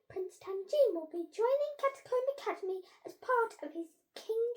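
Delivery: high, loud proclamation to the school; head teacher announcing. A narrator's reading. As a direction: announce it grandly, pitched well up.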